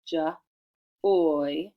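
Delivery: "J, oy" as juh, pause, long oy; short isolated "J", then a full "oy"; tapping out sounds. Shown as a demonstration. Teacher tapping out joy, "J, oy" sounded separately. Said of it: "Let's tap out joy".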